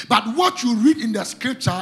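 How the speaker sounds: ordinary delivery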